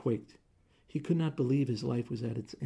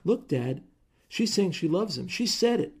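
excited shout; 7 semitones higher, 7 dB louder than usual. Acted. Daughter excitedly telling her father.

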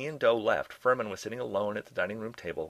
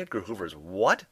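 louder, incredulous comeback; shot back; 5 dB louder than usual. A narrator's reading. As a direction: fire the question back, rising at the end. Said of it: "Hershey shot back".

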